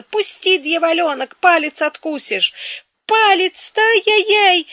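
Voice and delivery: high, loud, panicked demand; yelling in pain. A narrator's reading. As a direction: yell to get your bitten finger freed. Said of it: "заорала вдруг басом царица".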